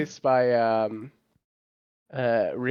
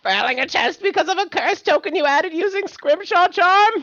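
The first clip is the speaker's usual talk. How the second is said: very high-pitched character rant; high cartoon screech. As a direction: screech the whole question like an outraged cartoon. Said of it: "I'm gonna wake up my neighbors".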